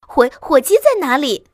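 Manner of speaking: put-on high, loud child's question; small fox child. A narrator's reading. Smallest fox searching the dark cellar, eager child voice. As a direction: ask like an eager little one.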